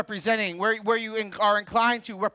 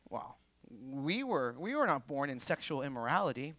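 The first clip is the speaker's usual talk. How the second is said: mocking Pharisee; 7 semitones lower, 11 dB quieter than usual. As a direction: voice the Pharisees' snide jab, much lower and quieter. Pharisees' mocking retort to Jesus.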